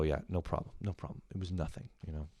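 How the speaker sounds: softly humble shrug of a reply; answering parents' thanks with fake humility; mock modest reply. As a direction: play it falsely modest and quiet. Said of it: "I go".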